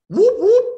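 high loud whoop whoop of a police siren; siren imitation. Man retelling a stop imitates the police siren, high whooping. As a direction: mimic the cop car siren.

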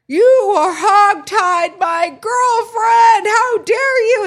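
high, loud wailing mock boyfriend; crying boyfriend. Boyfriend outraged at police over his girlfriend, high and wailing. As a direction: wail it like a distraught boyfriend. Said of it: "practically crying".

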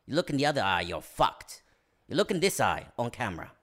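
measured Cockney acting coach; nasal, deliberate impression; Michael Caine. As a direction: deliver advice in the actor's British voice. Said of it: "That's him giving acting advice".

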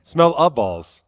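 "Smell a balls" slow, each word apart; staccato. Shown as a demonstration. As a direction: break "Smell a balls" into single words. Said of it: "one word at a time".

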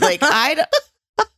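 high, breaking into laughter; laughing. Real, not acted. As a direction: crack up mid-word. Laughing at the memory.

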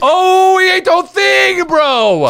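high, loud shout of disbelief; excited shout. Real, not acted. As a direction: yell it out in shock. Reacting to Nick eating chip.

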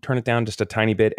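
high, gentle polite plea; good-moment gentle request. Retold or imitated. Parent asking noisy kids at dinner, gently. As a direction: ask lightly, keeping frustration out. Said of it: "in good moments going".

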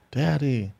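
put-on soft little kid calling Dad; lightly voiced scared child; mock frightened child. Voices his terrified kids.

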